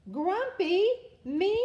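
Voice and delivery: high, loud shocked disbelief; indignant surprise. A narrator's reading. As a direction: squeak out the outrage. Jim indignant again.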